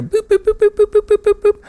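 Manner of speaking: monitor beeps. Retold or imitated. Heart monitor beeping.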